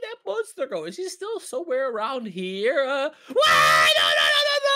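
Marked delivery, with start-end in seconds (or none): 2.9-4.7 loud, terrified screaming. Real, not acted. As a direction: scream in sheer terror. Monster appears.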